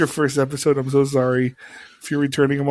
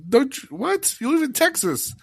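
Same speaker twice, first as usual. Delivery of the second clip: shocked disbelief. Real, not acted. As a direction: voice jumps high in disbelief. Disbelief Six Flags gone.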